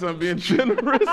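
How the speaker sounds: loud words broken by a laugh; chuckling while he says it. Real, not acted. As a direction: laugh mid-line. Laughing at the bombed sketch.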